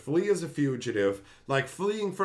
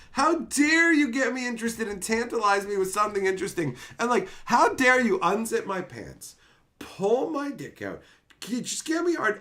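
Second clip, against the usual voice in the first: high, loud, indignant tirade; outraged rant; 9 semitones higher, 4 dB louder than usual. Retold or imitated. Cheated viewer rails at the show for teasing him, indignant rant.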